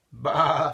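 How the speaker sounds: bleating sheep sound; sheep baa. Acted.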